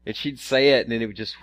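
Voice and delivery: ordinary talk, nothing marked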